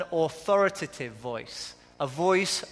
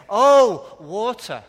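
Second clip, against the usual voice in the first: mock sudden realisation; 3 semitones higher, 11 dB louder, slower than usual. Waiter finally understands the American wife, loud mock realisation. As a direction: act out the penny dropping with a big "Oh". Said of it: "on the third time, he'll say".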